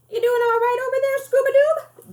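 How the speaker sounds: put-on high, bright old-lady voice, quick and loud; cheery high grandma teasing her grandson. A narrator's reading. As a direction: pitch it way up as the playful grandmother. Grandma Jima voiced, high and far.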